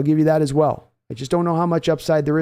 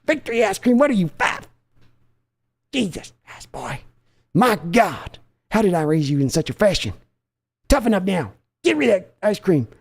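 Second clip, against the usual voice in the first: scolding father; 3 semitones higher than usual. Acted. Father mocking son's ice cream.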